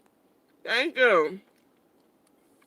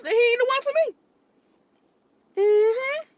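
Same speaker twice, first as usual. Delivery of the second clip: sassy; 12 semitones higher, slower than usual. Real, not acted. High drawn-out sassy line.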